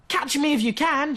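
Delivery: very high, loud teasing call; playful cry. Acted. Squirrel daring Fox to chase her, high and loud. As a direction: dare him to chase you. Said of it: "she cried".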